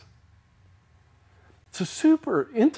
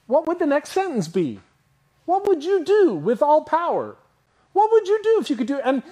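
rising urgency; 10 semitones higher, 3 dB louder than usual. Real, not acted. Preacher building rhetorical questions.

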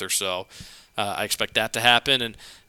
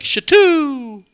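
high, loud yell of the name; 7 dB louder than usual. Shown as a demonstration. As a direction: shout it out like a fan. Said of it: "just yell and be like".

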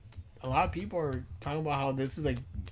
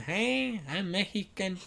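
put-on Mexican accent; 4 semitones higher, slower than usual. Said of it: "Like Mexicans".

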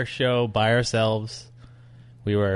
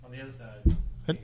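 quiet echo; 3 semitones higher, 6 dB quieter, slower than usual. Acted. Echoing the line back.

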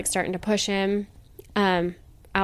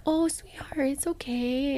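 hushed, higher, tender; a stranger hushes a crying two-year-old in a higher whisper; soothing whisper; 5 semitones higher, 5 dB quieter than usual. Retold or imitated. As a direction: whisper comfort to a crying toddler.

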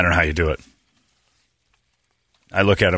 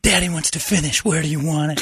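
mock dirty talk; 7 semitones higher than usual. Shown as a demonstration. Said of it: "Say that".

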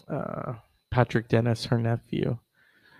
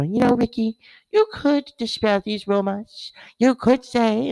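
high, coaxing interviewer voice; Barbara Walters impression; 9 semitones higher than usual. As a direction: coax him like Barbara Walters.

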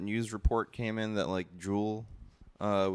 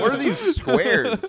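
slow, high and laughing; laughing mock outrage; 8 dB louder, slower than usual. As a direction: laugh through a mock complaint. Mocking plain packaging.

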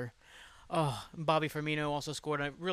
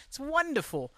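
mock wonderful; 11 semitones higher, 3 dB louder than usual. Sarcastic about figuring it out.

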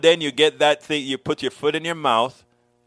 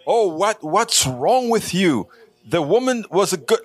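mock indignant critic; 4 semitones higher than usual. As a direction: parody the critics scolding him. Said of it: "you can tear me up for saying".

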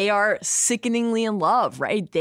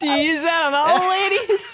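higher and louder, laughing through it; laughing tease; 10 semitones higher, 5 dB louder than usual. Real, not acted. Co-host teasing Jon about his line, laughing. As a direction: tease him while cracking up.

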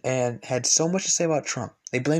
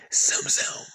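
breathy whispered zoom zoom; whispered jingle. Retold or imitated. Imagined ad team redoing the jingle, whispered. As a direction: whisper the slogan again. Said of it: "let's do it again".

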